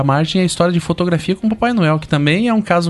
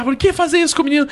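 higher reproachful granny; scolding grandma; 8 semitones higher than usual. Retold or imitated. Grandmother scolding the father for the prank, reproachful. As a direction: tell him off like a grandmother. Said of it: "minha avó deu um tapa no meu pai".

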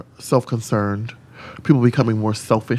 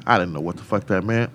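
normal talking voice

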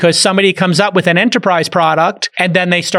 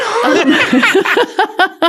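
big laugh. Real, not acted. Laughing at the joke.

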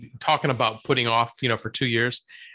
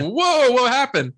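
startled; 10 semitones higher, 4 dB louder, slower than usual. Retold or imitated. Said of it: "He's like".

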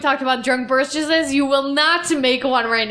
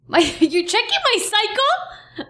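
high, slow laughing outburst; sudden loud outburst; 8 semitones higher, slower than usual. Real, not acted. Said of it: "Sorry, that was loud".

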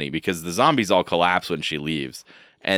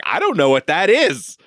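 confused villain; 8 semitones higher, 4 dB louder than usual. Retold or imitated. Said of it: "She's like".

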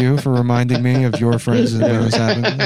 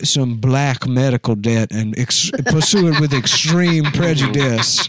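hammed-up Southern dandy. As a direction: declaim it like a genteel Southern gentleman. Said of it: "My cool Southern dandy voice".

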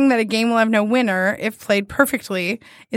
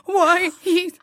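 mock grieving child; 8 semitones higher than usual. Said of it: "And I'd go".

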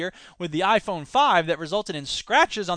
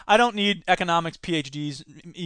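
ordinary talk

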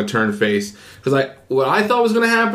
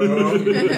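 laugh; 5 semitones higher than usual. Real, not acted. Laughing at own joke.

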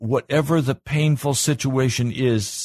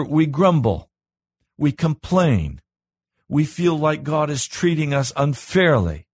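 preaching at full force; 6 semitones higher than usual. Acted. Pastor urging his flock to mature, fervent preaching. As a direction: drive it home to the congregation.

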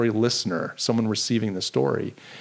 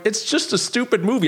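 high, squeezed words through a tight throat; fighting back tears, barely squeaking it out; choked-up squeak; 9 semitones higher than usual. Retold or imitated. Husband choked up, telling wife it's just a movie, high squeak. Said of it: "I can hardly squeak the words out".

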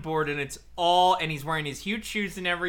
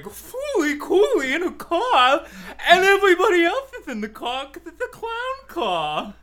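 high, loud goofy clown narrator; 12 semitones higher, 6 dB louder than usual. Pretend. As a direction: play a silly clown narrator, laughing. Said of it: "that was my clown impression".

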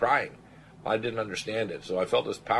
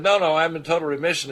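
excited news; 8 semitones higher, 7 dB louder than usual. Retold or imitated. Patient surprising doctor with news.

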